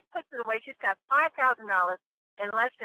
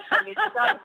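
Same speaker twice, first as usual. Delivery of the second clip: bursting into a high laugh; 10 semitones higher, 5 dB louder than usual. Real, not acted. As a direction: laugh out the good news. Joyful reveal, laughing.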